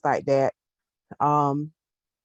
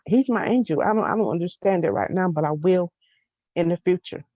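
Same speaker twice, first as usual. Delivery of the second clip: ordinary talk, nothing marked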